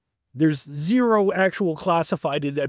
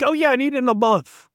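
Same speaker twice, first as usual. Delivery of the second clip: breezy quote; 9 semitones higher, 4 dB louder than usual. Retold or imitated. Holmes quoted in passing.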